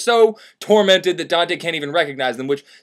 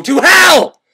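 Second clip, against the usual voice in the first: louder, exasperated finish; exasperated outburst; 9 semitones higher, 10 dB louder than usual. Acted. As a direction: build to a loud, fed-up shout at the end.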